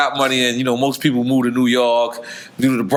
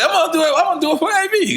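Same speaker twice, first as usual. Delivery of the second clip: high hyped-up yell; yelling at a horse race; faster than usual. Real, not acted. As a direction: cheer yourself on like at the track. Hyping up to take a shot.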